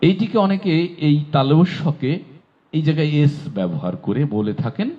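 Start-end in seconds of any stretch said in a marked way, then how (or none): none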